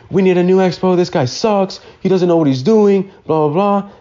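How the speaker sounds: high-pitched imitation of a griping coworker; mock angry ranting. Angry cook complaining about the new expo, mock ranting. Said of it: "he got all mad".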